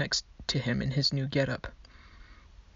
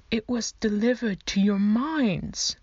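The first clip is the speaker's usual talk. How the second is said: slow, higher, lofty skeleton voice with a fake accent; drawn out like a grand pronouncement; skeleton's fake accent; 7 semitones higher, 4 dB louder, slower than usual. A narrator's reading. Skeleton clerk lecturing the annoyed twins in fake accent.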